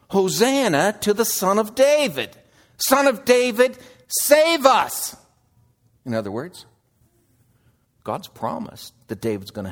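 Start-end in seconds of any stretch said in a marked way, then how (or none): 0.1-5.2 high, loud cry of praise; children calling out. Acted. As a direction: shout it up like excited children. Children crying out in temple.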